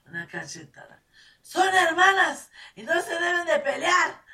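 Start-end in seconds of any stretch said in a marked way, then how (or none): 1.4-4.2 high, loud stern rebuke; mother scolding two fighting sisters. Retold or imitated. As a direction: tell them off hard.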